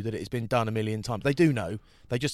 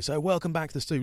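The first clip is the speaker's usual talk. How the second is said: presenter voice; 6 semitones higher, faster than usual. Retold or imitated. Mock presenter opening a link.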